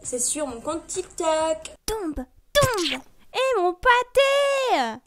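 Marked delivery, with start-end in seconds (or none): 1.9-5.0 very high, loud, slow wail over the lost sandcastle; drawn-out mock-tragic cry. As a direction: mourn the sandcastle like a child. Repeating the sandcastle ref.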